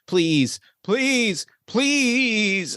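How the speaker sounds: high, drawn-out begging pleas; pleading, begging. Acted. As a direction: beg desperately, pitch leaping up on each plea. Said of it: "this sort of pleading, begging".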